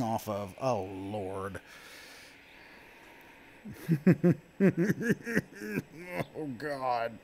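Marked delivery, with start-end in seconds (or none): 3.6-6.3 high giddy laugh; nervous laugh. Real, not acted. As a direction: laugh out the nerves. Nervous laugh on coaster drop.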